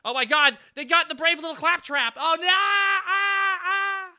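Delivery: high, loud cry of dismay; panicked shout. Real, not acted. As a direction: yell out as the character goes down. Game character killed, shouts.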